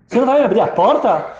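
high, loud insistent question; exasperated demand. Retold or imitated. Locked-out wife to husband, exasperated demand. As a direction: press harder through the closed door.

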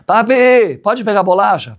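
put-on high small-child voice asking. Child asking father for cookies, high pleading. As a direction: beg for a cookie like a kid.

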